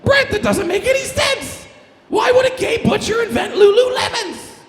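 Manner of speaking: very high, loud puppet voice yelling in disbelief; Elmo voice. Acted. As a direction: squeal it like Elmo calling Brett out. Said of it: "Well, Elmo".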